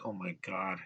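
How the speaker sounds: soft, low disgusted aside; imitating disgust. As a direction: mutter your horror under your breath. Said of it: "I'm like".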